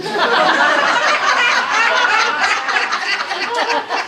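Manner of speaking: loud, high hearty laugh; big burst of laughter. Real, not acted. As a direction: laugh out loud, falling away. Speaker cracks up at own story.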